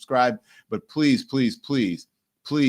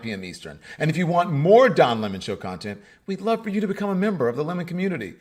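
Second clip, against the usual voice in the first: upbeat, higher channel-promo read; outro announcer read; 4 semitones higher than usual. Acted. Host reading the sign-off promo to viewers, bright announcer voice. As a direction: sell the membership like a closing ad.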